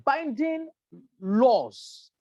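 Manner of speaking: "binding" pitched high and set apart; stress on binding. Real, not acted. Speaker underlines the lack of binding safety laws, emphatic on "binding". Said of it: "underline the word binding".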